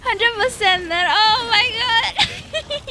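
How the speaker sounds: loud, high hundred percent, stretched oh my god; excited agreement. Real, not acted. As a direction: gush in loud delight. Strong agreement with chat.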